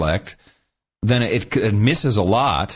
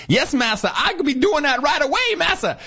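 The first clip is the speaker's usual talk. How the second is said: much higher, put-on servant voice from an old book; old novel dialect voice. Podcaster showing how old book dialect reads aloud, high mock voice. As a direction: ham up the dated dialect of the original text. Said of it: "how can you read that without reading it like that".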